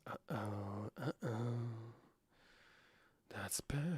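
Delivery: whispered under his breath. Real, not acted. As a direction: breathe it out, barely voiced. Streamer reacts under his breath.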